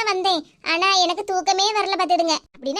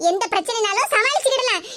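ordinary talk

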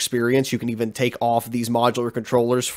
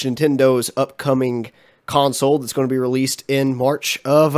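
usual speaking voice

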